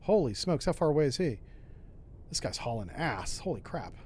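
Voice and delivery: usual speaking voice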